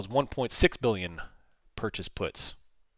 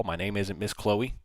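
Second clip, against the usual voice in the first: ordinary talk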